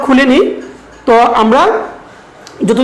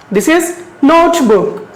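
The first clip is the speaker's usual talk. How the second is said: This is notebook very slow, a little higher; slow model reading; 3 semitones higher, much slower than usual. Shown as a demonstration. Teacher modelling notebook slowly for the class. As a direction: pronounce notebook slowly so pupils can follow. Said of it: "can you pronounce it?".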